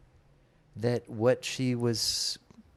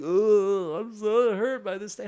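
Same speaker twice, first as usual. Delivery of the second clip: mock whiny hurt; 11 semitones higher, 5 dB louder, slower than usual. Voicing his hurt inner self.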